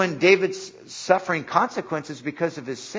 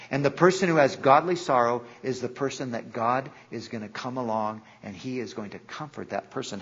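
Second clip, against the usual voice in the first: ordinary talk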